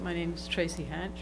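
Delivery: far from the mic, very faint; barely audible. Real, not acted. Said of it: "Could you speak up".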